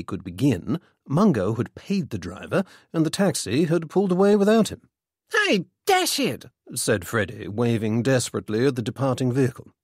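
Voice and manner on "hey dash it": high, drawn-out protest; dismayed calling out. A narrator's reading. Freddy to the departing taxi, dismayed call.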